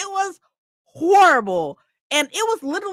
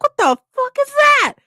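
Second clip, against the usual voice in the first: high, louder stunned question; shocked exclamation; 6 dB louder than usual. Retold or imitated. Leaving church into humidity, shocked. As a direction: gasp it out in disbelief.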